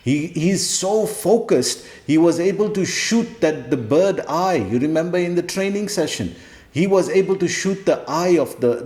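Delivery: ordinary talk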